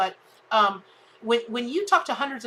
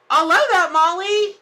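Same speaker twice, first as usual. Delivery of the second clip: delighted; 9 semitones higher, 10 dB louder than usual. Real, not acted. Reacting delightedly to a viewer comment.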